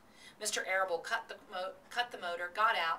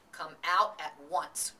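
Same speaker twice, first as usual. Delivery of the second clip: stern cry; 5 dB louder, slower than usual. A narrator's reading. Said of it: "cried Missus Arable".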